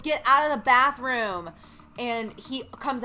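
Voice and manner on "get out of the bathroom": a retold yell, louder and a little higher; yelling through the door. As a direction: bark it at someone hogging the bathroom. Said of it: "she's like".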